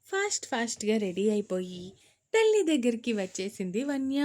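ordinary delivery, nothing marked

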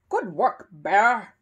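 loud, hearty approval from a gruff neighbour; approving. A narrator's reading. Mr Curry pleased at finding brick rubble, approving. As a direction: approve firmly, hitting the last word loud. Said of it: "he said approvingly".

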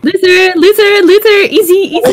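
loud sing-song taunt; mock the loser with glee. Real, not acted. Taunting a defeated player.

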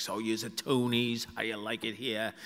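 a hint of a thick Boston townie asking; Boston townie accent, unhurried. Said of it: "She's like".

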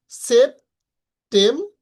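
সেপ্টেম্বর said very slowly, syllable by syllable, rising at the end; syllables drawn out. Shown as a demonstration. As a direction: stretch out সেপ্টেম্বর into its three syllables. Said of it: "এখানে তিনটা syllable আছে".

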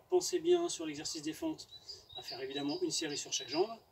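ordinary talk

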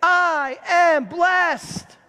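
each word of I am blessed drawn out slow; very slow congregational affirmation; slow declaration. Shown as a demonstration. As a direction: lead the room word by word. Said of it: "Say it with me".

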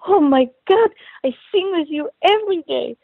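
high, thrilled squeal of recognition; excited gush. Retold or imitated. Ride operator gushing to the singer she recognizes, high and thrilled. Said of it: "throws her arms in the air and says".

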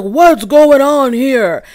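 slow booming teacher voice; like a teacher quieting a rowdy class; slow, loud, drawn out authoritative question; teacher calming class. Retold or imitated. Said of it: "teacher trying to calm down the class voice".

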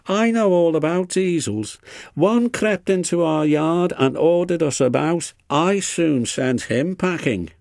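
high-voiced boastful engine. A narrator's reading. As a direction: brag grandly in a high voice. James boasts to Duncan.

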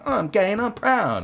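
high, loud, drawn-out mock chant; hammed-up proud cry, stretched out; flamboyant marcher. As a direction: imitate a parade-goer proclaiming it. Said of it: "all their fucking flamboyant shit going on".

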